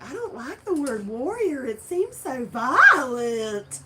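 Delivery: high, slow mocking voice of a squeamish objector. Quoting people who dislike the word, high mocking voice. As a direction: mimic someone who finds the word too violent. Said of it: "Some people are like".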